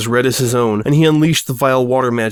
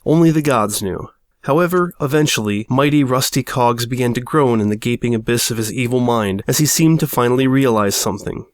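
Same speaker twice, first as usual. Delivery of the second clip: plain reading voice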